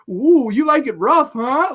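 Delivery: put-on high, loud teasing girl.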